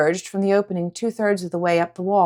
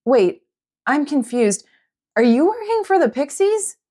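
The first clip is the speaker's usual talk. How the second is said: confused; 8 semitones higher, 3 dB louder than usual. Acted. Confused girl questioning dragon.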